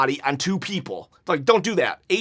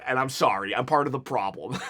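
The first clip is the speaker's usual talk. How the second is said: sheepish laugh; 6 semitones lower, 6 dB quieter than usual. Real, not acted.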